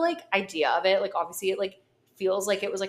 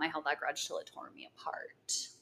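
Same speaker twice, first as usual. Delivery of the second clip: whispering; 4 semitones lower, 9 dB quieter than usual. Acted. Quoting a lyric softly.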